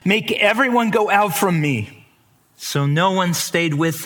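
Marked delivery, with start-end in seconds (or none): 0.0-2.1 commanding cry. Acted. Joseph ordering everyone out before revealing himself, raised voice. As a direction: order the room cleared in a raised voice. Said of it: "He cried".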